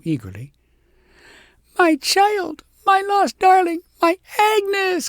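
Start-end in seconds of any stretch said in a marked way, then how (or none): 1.8-5.1 much higher, louder, overjoyed; joyful cry. A narrator's reading. Shepherdess cries out to her found daughter, high and loud. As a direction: cry out to the lost child. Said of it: "cried the shepherdess".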